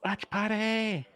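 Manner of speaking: high, drawn-out singsong cheer; playful cheer. Real, not acted. Co-host cheering the watch party idea, playful cheer. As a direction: stretch it out high, like a gleeful little chant.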